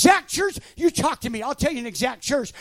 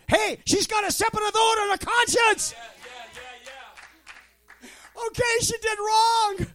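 fired-up preaching; 6 semitones higher, 3 dB quieter than usual. Real, not acted. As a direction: call it out to the congregation. Excited preaching aside.

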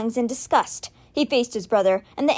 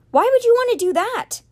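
very high, incredulous protest; confused disbelief; 12 semitones higher, 4 dB louder than usual. A narrator's reading. Alex questions his brother's decision, incredulous. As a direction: voice shooting up in dismay. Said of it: "Alex looked confused for a moment".

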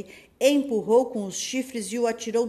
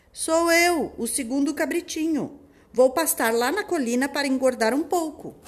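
little goat voice; 7 semitones higher, 6 dB louder than usual. Acted. Second goat answers wolf.

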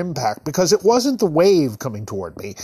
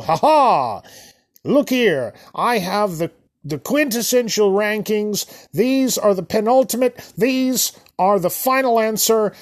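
higher-pitched know-it-all proclamation; mock gloating; 5 semitones higher than usual. Online ranker jamming rankings in your face, gloating. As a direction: parody a smug self-appointed authority.

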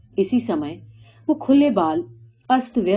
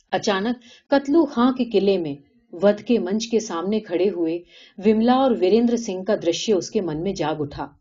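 plain reading voice

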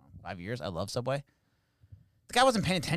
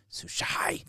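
breathy whisper of the word; whispered punchline. Retold or imitated. Retelling her son's complaint, whispered punchline. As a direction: drop it to a whisper for the joke.

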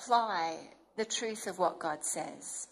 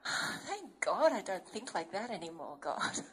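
laughing relief; 4 semitones lower, 6 dB quieter than usual. Retold or imitated. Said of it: "You go".

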